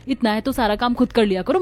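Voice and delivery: ordinary talk, nothing marked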